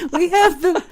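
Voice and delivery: laughing. Real, not acted. Host laughing at onlookers outside.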